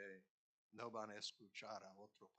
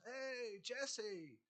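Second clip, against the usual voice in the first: calling out; 11 semitones higher, 5 dB louder than usual. Retold or imitated. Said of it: "yo fui y con mi voz".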